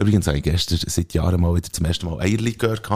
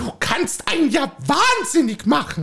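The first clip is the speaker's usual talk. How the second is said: a higher, fussy housewife voice pestering her husband; nagging wife. Acted. Wife nagging her husband to go for a walk, fussy. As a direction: play the nagging wife in a comic sketch.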